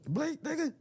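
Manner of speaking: high-pitched teasing yell; high mock yell. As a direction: squeal it out jokingly. Mock command to Darius.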